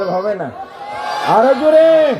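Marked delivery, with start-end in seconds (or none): 1.3-2.1 high, loud call for more; louder. Shown as a demonstration. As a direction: push the crowd to shout louder. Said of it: "না, আরো জোরে বল". (আরো জোরে চিৎকার)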